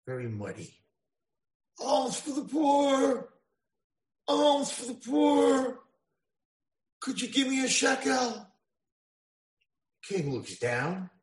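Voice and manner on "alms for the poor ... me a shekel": high, slow drawn-out call for alms; beggar's plea. Acted. Muddy beggar girl calling to the passing king, high and slow. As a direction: wail it like a street beggar.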